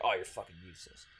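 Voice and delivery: low, quick sneering jab; mock insult. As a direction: toss it off as a cruel commenter. Mock quote of a harsh commenter.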